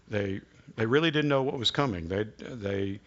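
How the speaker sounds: ordinary talk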